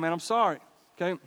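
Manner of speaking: ordinary talk, nothing marked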